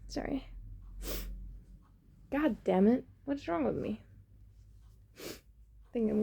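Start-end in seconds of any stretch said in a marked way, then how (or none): none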